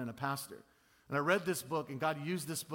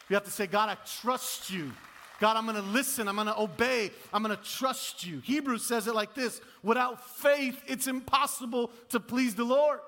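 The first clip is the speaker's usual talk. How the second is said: loud, raised-pitch sermon delivery; forceful preaching; 6 semitones higher, 5 dB louder than usual. Real, not acted. Preacher urges congregation to trust God, forceful preaching.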